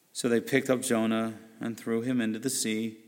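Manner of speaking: ordinary delivery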